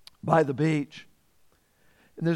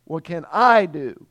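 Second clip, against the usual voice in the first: "I" punched louder than the other words; stress on I; 4 dB louder than usual. Shown as a demonstration. Preacher repeating the ruler's question, emphasis on "I". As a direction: hit the word "I" firmly. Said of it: "Let me put the emphasis there".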